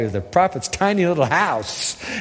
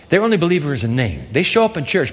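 normal talking voice

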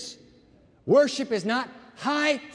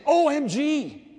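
high, drawn-out mock gasp of amazement; mock astonishment; 8 semitones higher, 4 dB louder, slower than usual. Texter reacting to a slam dunk, mock-amazed. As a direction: stretch each letter high in exaggerated awe.

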